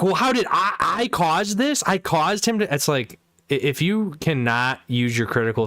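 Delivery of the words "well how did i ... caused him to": higher, whiny retold protest; mock his defensive excuse, pitched up; mimicking defensive friend. Retelling an argument, mimics friend's higher defensive whine.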